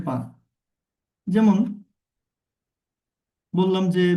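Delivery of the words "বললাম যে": usual speaking voice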